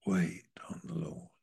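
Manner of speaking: hushed, reverent whisper of "wait on the Lord". Acted. Preacher echoing the verse to the congregation in a whisper.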